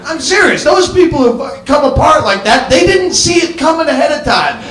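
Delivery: usual speaking voice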